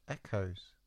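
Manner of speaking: soft, repeated echo effect; fading echo. Pretend. Narrator mimics echoes for chapter title, fading. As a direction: repeat it, dying away.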